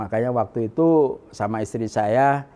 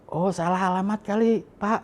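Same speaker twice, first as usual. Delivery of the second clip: higher-pitched retold reply; imitated sister-in-law; 9 semitones higher than usual. Sister-in-law answering a supposed stranger, imitated higher voice. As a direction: lift the pitch to voice her answer.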